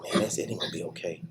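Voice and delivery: whispered, hopeless reply; doubtful whisper. Retold or imitated. Prisoner whispering back to God in despair. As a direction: breathe it out like you don't believe it.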